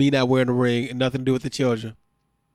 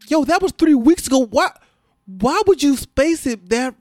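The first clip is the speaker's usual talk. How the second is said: much higher and louder, incredulous; exasperated disbelief; 9 semitones higher, 3 dB louder than usual. Retold or imitated. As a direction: throw up your hands at the schedule. Retold reaction, "I'm like.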